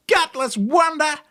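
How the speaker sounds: high, loud parting insult; yell. A narrator's reading. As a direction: spit it out as you vanish. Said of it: "had time to yell".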